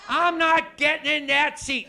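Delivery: put-on high, loud, slow defiant old mom; stubborn old mother. Elderly mother refusing the booster seat, high loud defiance.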